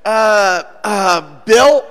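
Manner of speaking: loud, hesitant guess at a name; unsure greeting. Acted. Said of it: "you see an old friend and you go".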